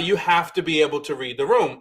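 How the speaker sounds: usual speaking voice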